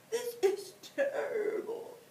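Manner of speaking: high wailing moan. Acted. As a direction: wail it like a big sad bear. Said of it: "he moaned".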